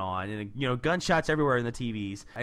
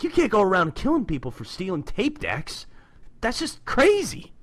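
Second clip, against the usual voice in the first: lightly voiced comic-book Raph, a bit higher; Raph quoted; 7 semitones higher, 3 dB louder than usual. Retelling Raph's scolding of Casey, lightly voiced reproach. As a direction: read the Turtle's line with mild reproach. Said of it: "and he's like".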